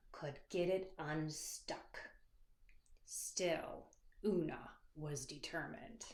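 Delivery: soft, hushed narration; whispered suspense. A narrator's reading. Narrator whispering about the stuck crown. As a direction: keep it low and secret.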